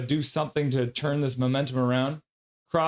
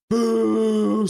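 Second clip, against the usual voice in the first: loud, higher, held-out crowd boo; drawn-out boo; 7 semitones higher, 6 dB louder than usual. Retold or imitated. Host acting out a viewer's chat message, booing. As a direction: boo like a heckling fan.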